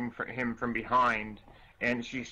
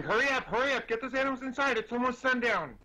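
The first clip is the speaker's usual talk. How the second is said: high-pitched urgent holler; dwarf hollering orders, pitched well up; 9 semitones higher, faster than usual. Acted. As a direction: hurry everyone indoors with a high, insistent call. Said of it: "waving at the rest of you and hollering".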